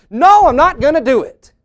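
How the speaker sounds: high, very loud mock protest; mock defiant refusal. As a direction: blurt out an exaggerated, outraged no.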